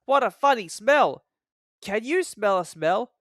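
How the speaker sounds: high, cheeky little engine sniff; scornful little engine; Thomas remarks. A narrator's reading.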